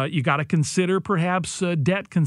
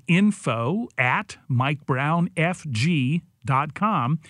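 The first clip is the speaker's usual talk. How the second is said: spelling it out slowly; each part deliberate; slow and clear; much slower than usual. Shown as a demonstration. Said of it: "spells out email slowly".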